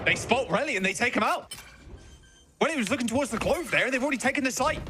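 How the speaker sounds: high, excited live call; esports caster. Real, not acted. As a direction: call the site take, pitch up. Live play-by-play of site take.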